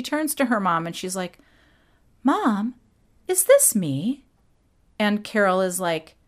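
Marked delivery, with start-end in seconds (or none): 2.2-4.2 put-on a higher, wondering girl's voice; a hint of an awed young girl; [name]'s impression. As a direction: lightly voice the girl asking her mom. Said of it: "I'm gonna be doing some impressions".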